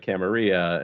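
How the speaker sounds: Camarilla ending "REE-ah", like tortilla; pronounced like tortilla. Shown as a demonstration. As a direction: say Camarilla as a Spanish word, cam-a-REE-ah. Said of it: "it's pronounced like tortilla".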